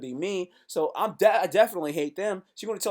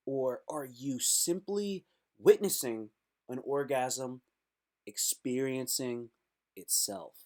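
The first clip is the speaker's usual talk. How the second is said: slow hushed mock profound; 6 semitones lower, 7 dB quieter, slower than usual. Shown as a demonstration. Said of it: "philosophical line said twice".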